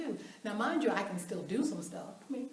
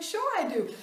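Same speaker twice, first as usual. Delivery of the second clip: eager laughing protest; 9 semitones higher, 4 dB louder than usual. Retold or imitated.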